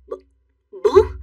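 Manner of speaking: startled, stammered question; stunned disbelief. Acted. Daughter hears her mother's harsh retort, stunned.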